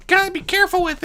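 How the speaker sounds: quick, high trickster voice; a mischievous god talking shop, pitched high; high Discord voice. A narrator's reading. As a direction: rattle it off high and lightly. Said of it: "Discord observed".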